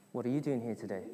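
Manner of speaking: low, soft everyday question; understated, hushed morning question; spouse asking. Acted. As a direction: ask it quietly as a spouse would. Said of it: "your spouse would turn around and say".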